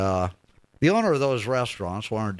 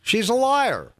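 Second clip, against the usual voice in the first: high, loud outburst; exclaiming; 5 dB louder, slower than usual. Real, not acted. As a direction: blurt it out, much higher. Host mocks candidate on air.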